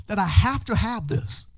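desperate. Shown as a demonstration. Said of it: "with a sense of desperation".

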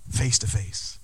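normal talking voice

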